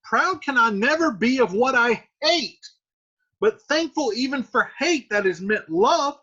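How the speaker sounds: high, measured Juliet voice; Juliet reading. Acted. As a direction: read as the calm daughter. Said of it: "And Juliet says".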